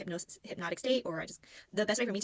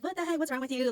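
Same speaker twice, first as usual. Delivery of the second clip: teasing friends; 8 semitones higher, 6 dB louder than usual. Retold or imitated. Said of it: "They're laughing kinda at me".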